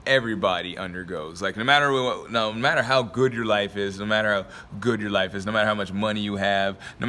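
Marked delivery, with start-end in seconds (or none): none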